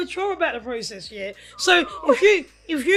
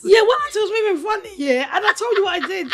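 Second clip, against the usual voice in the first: excited; 7 semitones higher, 6 dB louder, faster than usual. Real, not acted. Excited retelling, laughing.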